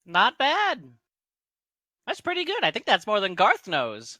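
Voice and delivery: high-pitched, piping praise; halfling approval. Acted. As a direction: pitch it well up, lively little halfling. GM voices halfling praising player.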